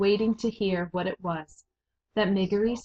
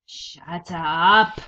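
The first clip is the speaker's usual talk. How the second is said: loud, curt rat snarl; louder angry order; snapped shut up; 8 dB louder than usual. A narrator's reading. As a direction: shut her down hard. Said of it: "said the rat".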